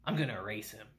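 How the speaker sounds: ominous vow, low and quiet; cold threat. Retold or imitated. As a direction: say it flat and quiet, dead serious. Takafumi vows menace.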